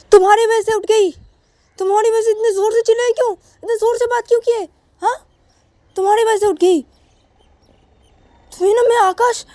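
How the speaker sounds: wife blames husband for waking their daughter, furious. Acted. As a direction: rage at him for waking the baby. Sakshi furious, baby woke.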